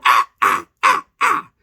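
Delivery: breathy then high falsetto ah ah as if sliding; mock slipping cries. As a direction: imitate people slipping with little yelps. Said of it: "watch the people go".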